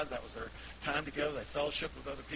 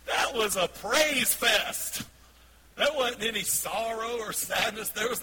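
loud, high, drawn-out exclamation; exultant; 6 semitones higher, 8 dB louder, slower than usual. Real, not acted. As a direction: proclaim it with rejoicing, slowly. Exclaims joyfully about celebration.

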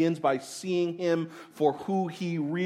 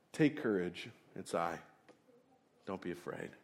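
reassuring Jesus; 8 semitones lower, 12 dB quieter than usual. Acted. Jesus reassures frightened disciples.

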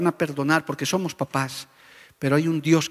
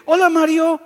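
mock young kid's greeting, much higher; cocky kid's greeting, pitched up; cheeky youngster; 12 semitones higher, 6 dB louder than usual. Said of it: "Hay jovencitos que dicen". (muchachito descarado, voz más aguda)

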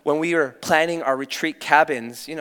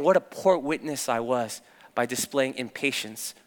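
normal talking voice

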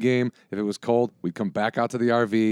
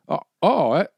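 higher, louder, fumbling backpedal; caught-out fan; 8 semitones higher, 6 dB louder than usual. Retold or imitated. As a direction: mimic a fan suddenly deflated and nice. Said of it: "And the guy goes".

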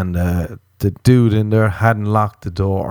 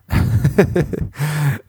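high giggling laugh; amused laugh; 7 semitones higher than usual. Real, not acted. Comedian tickled by a stranger's offer, amused laugh. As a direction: giggle helplessly at your own story. Laughs at his own story.

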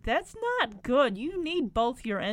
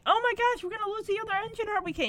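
high, fast panicky squeal; hammed-up panic, rushed and high; mock freaking out; 9 semitones higher, much faster than usual. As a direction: imitate a scared kid blurting it all out. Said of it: "And Rick's freaking".

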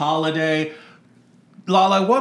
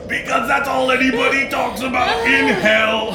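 higher, shouty mock ghost; Robert Frost voice; 7 semitones higher than usual. Ghost poet defending how he talks, high and shouty. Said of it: "Robert Frost".

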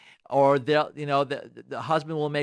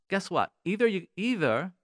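ordinary delivery

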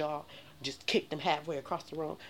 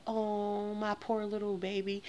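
doting mother; 8 semitones higher, slower than usual. Retold or imitated. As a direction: coo over the child like a fussy mother. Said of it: "And she was like".

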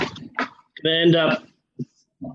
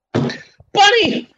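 calling out; 4 dB louder than usual. Real, not acted. Calling co-host loudly.

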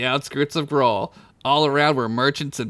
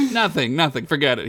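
usual speaking voice

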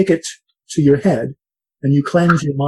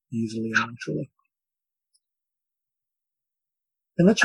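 soft spa whisper; 6 dB quieter than usual. Acted. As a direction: ease them gently into calm. Leads a guided breathing meditation.

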